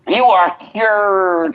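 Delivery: high, slow Southern preacher pronouncement; Southern faith healer. Retold or imitated. Mocking a faith healer curing someone, high and slow. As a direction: declare the healing slowly. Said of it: "like a Southern Baptist".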